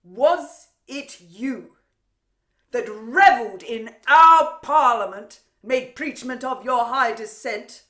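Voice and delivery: loud, ringing scornful challenge; high, forceful theatrical taunt; scornful accusation. Acted. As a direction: hurl it as a public accusation. Queen taunts captive rival.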